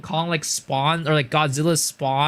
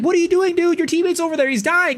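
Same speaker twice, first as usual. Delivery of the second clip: much higher, louder urgent commands; urgent yell; 13 semitones higher, 4 dB louder, faster than usual. Retold or imitated. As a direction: shout orders at the kid. Urging kid to help teammate.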